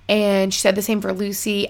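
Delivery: ordinary talk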